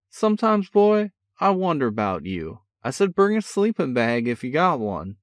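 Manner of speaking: a cocky big brother, full of energy; brash older brother. A narrator's reading. Older brother teasing on the phone, brash and cheerful. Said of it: "Sean's voice carried on excitedly".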